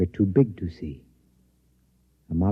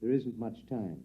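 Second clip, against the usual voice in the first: hushed reply; 2 semitones higher, 14 dB quieter, slower than usual. Acted. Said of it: "Where are you going, Professor?".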